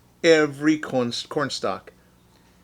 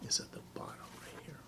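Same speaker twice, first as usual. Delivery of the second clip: fast hushed whisper; 20 dB quieter, faster than usual. Real, not acted. Teacher whispering the bottom's place to children. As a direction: softly point it out.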